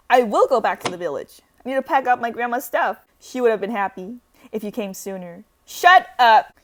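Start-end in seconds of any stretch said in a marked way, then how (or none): 5.6-6.6 loud shout; angry snap. Acted. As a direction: shut him down hard. Telling the caller off.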